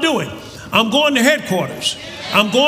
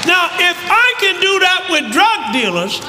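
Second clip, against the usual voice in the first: high, impassioned pulpit shout; full-force preaching; 7 semitones higher than usual. Real, not acted.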